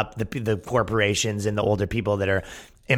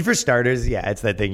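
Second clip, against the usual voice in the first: ordinary talk, nothing marked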